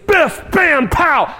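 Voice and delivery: high, punchy comic-book bursts; cartoon fight exclamations. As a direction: punch out each word like a cartoon blow. Imitates cartoon fight sound words.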